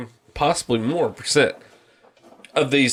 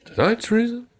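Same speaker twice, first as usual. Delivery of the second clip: higher-pitched mock announcement; segment announcer; 10 semitones higher than usual. Shown as a demonstration. Demonstrating the planned segment, mock announcer. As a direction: set up the punchline as announcer, pitched up. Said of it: "it's just gonna be".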